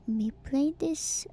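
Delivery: usual speaking voice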